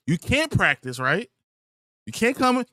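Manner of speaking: normal talking voice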